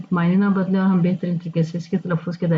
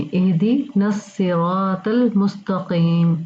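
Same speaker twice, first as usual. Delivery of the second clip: slow recitation; much slower than usual. Shown as a demonstration. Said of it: "Aahista Aahista".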